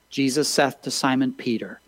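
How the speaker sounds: ordinary talk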